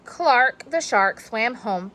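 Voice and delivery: ordinary delivery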